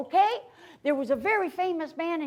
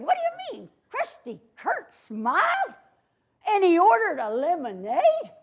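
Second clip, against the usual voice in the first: much higher, incredulous villain squawk; mock outrage; 4 semitones higher than usual. Gang leader hearing Crusty Kurt smiled, high and incredulous. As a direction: play the boss in cartoon disbelief.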